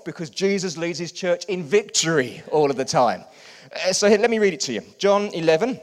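loud, rising preacher's call; preaching at full force. Acted. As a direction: lift the room, preaching hard.

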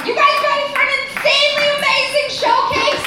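high, loud show-host voice; MC hyping the crowd. Acted. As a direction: whip the audience up for the showcase.

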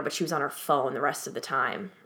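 usual speaking voice

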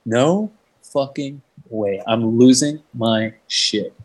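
very slow, word-by-word amazement; retold stunned reaction, spaced out; awed disbelief. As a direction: drag out each word in disbelief. Acted disbelief seeing his bag.

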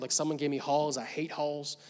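normal talking voice

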